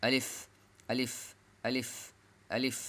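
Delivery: quick আলিফ, lips never meeting on the f; short, lips apart. Shown as a demonstration. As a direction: drill আলিফ short, with a rising tone and airy f. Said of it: "যতবার লিখবেন ততবার পড়বেন".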